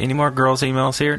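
ordinary talk, nothing marked

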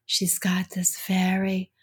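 slow, hushed radio-host impression; Lois Reitzes. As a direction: draw out "melodious" in an imitated public-radio voice. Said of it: "when you did that impression".

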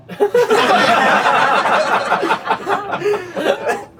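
a long high burst of laughter; big laugh. Acted. Kirk hearing his brain, ass and hawk DNA mix, high laughter. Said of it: "I say aloud with a laugh".

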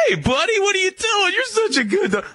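imitating an excited stranger fussing over the dog, way up high; overexcited dog petter. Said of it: "who goes".

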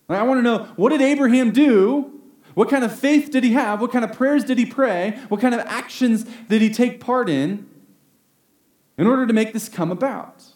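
keyed-up, urgent curiosity; eager questioning. Retold or imitated. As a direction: rattle off the questions like you're hunting for the key. Eager search for the key.

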